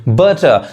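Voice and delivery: बटर said "buh-tuh", no r; British-style बटर ending in a schwa; silent r. Shown as a demonstration. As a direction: drop the final r of बटर. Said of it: "मेरे pronunciation पे ध्यान दीजिए".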